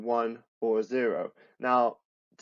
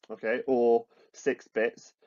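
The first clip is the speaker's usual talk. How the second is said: usual speaking voice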